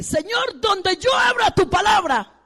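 high, loud, slow cry to God; fervent prayer. Retold or imitated. Retelling the daughter praying to God, fervent high cry.

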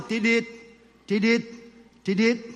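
imitating a steady monitor beep; heart monitor beep. Preacher imitates the monitor by the bed, light beeps. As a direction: mimic the bedside heart monitor. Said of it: "el monitor, en cuanto hace".

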